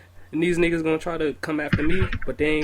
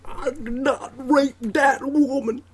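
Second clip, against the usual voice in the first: much higher, slow put-on old-man voice, trailing into a whisper; mock Bill Cosby voice; 9 semitones higher, slower than usual. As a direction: lampoon a famous comic denying it, drawn out then hushed. Joking Cosby jab after Bill Cosby mention.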